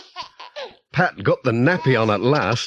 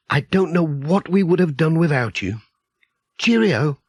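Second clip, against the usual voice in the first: lightly voiced motherly village woman, higher; a hint of a cheerful neighbour, pitched up; Mrs Pottage; 5 semitones higher than usual.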